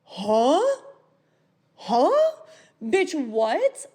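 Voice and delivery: loud, incredulous questions with long pauses; mock bafflement, jumping up in pitch; baffled disbelief. Reacting to it being already resolved, baffled.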